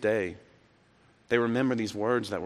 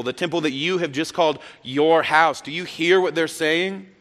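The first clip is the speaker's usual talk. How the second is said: high, loud, outraged accusation; indignant priests; 6 semitones higher, 5 dB louder than usual. Retold or imitated. Chief priests confronting Jesus, high indignant outrage. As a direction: demand an answer, indignant and rising. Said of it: "The Bible says they were indignant".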